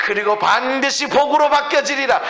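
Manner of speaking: high, driving sermon delivery; full-force preaching. Real, not acted. Preacher urging the congregation to resist the devil, full-force preaching.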